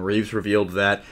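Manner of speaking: normal talking voice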